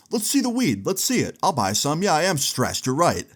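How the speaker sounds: high-pitched mock eagerness, imitating an angry man; sarcastic. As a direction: act out sarcastic willingness with exaggerated high pitch. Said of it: "sounding real, like, sarcastic about it".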